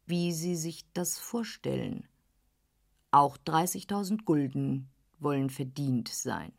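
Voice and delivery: ein Hauch tiefer, gönnerhafter Herr; leicht gespielter älterer Herr. Acted. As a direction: als älterer Herr väterlich zur jungen Frau. Dorsday spricht, tiefere Stimme. (Herr von Dorsday, leicht tiefer gesprochen, gönnerhaft)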